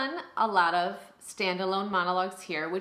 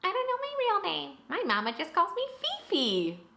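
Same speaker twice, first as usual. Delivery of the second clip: mocking young student; 12 semitones higher than usual. Teacher imitates a student who can't name himself, mockingly childish. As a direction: mimic a clueless pupil, pitched way up.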